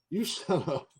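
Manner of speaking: ordinary delivery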